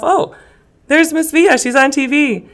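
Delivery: imitating a former student's delight; excited discovery. A former student spotting her teacher on TV, excitedly surprised.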